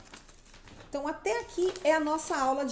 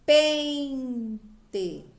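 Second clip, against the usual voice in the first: "Pente" with PEN loud and drawn out, TE dropping away; stressed syllable demo; 6 dB louder than usual. Shown as a demonstration. Teacher shows children the strong syllable, stressing PEN in "Pente". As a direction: punch and stretch the first syllable of "Pente". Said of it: "Qual que eu falei mais forte?".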